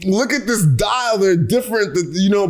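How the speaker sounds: high, incredulous protest; mock exasperation. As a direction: plead the case as an affronted watch lover. Mock argument with his mom.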